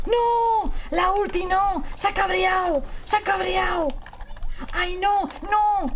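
high, drawn-out wails of dread; panicked cry. Real, not acted. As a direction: cry out in alarm at the coming attack. Panicking as enemy prepares ultimate. (grito agudo de pánico)

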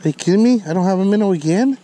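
ordinary talk

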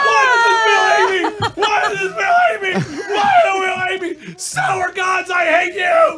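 angry shouting. Real, not acted. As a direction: scream it out in frustration. Loses sour candy spin, screaming.

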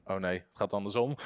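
a quiet mock groan of defeat; dismayed. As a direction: mutter the sudden realisation. Demon realises it lost.

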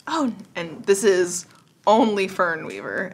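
ordinary talk, nothing marked

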